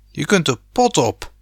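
a light, heated yell at Pa; heated shout. A narrator's reading. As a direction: snap back hot-headed. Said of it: "schreeuwde Rob verhit".

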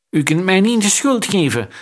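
shouting. A narrator's reading. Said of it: "schreeuwde zijn met sieraden behangen medeplichtige".

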